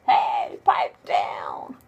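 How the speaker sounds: whispered mock shout; grumpy neighbor. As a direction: grumble at the noise under breath. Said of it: "this guy says".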